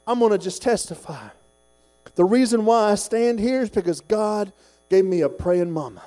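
normal talking voice

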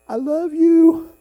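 high, loud, long-drawn call; God calling out love. Acted. God speaking through a sunset to a driver, loud drawn-out call. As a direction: sing it out slowly across the sky. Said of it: "You know how he says it to me?".

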